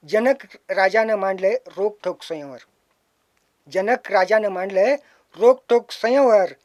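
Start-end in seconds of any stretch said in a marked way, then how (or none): 3.7-6.5 louder recital. Shown as a demonstration. Poet reciting his Ramayana remake, louder and fuller. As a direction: lift it louder at the end.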